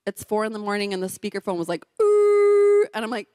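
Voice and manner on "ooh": loud, very high electronic whoop; imitated phone blare. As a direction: mimic the speakerphone blaring. Speakerphone noise imitated.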